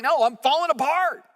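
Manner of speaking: a desperate woman at the tabernacle, crying out; desperate plea. Retold or imitated. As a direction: plead it out, on the edge of breaking. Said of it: "She's like".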